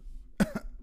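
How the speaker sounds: sharp choking cough; coughing fit. Real, not acted. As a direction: cough after swallowing wrong. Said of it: "oh, ich hab mich verschluckt".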